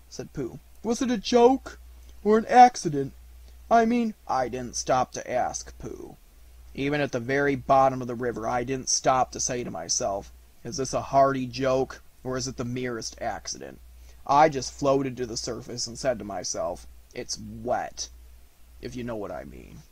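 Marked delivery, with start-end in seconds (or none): 0.8-4.1 Pooh voice. Acted. Pooh asking. 4.3-19.8 Eeyore voice. Acted. Eeyore voice, gloomy.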